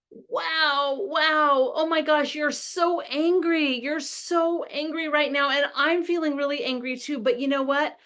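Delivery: high-pitched, worked-up exclaiming; animated, emotional parent. Acted. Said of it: "with a lot more emotion".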